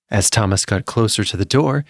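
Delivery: usual speaking voice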